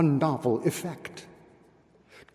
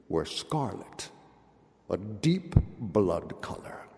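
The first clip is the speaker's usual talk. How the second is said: lower, softer, foreboding narration; ominous hush; 8 semitones lower, 8 dB quieter than usual. A narrator's reading. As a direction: drop the voice low and soft for the blood color. Ominous description of blood-red panes.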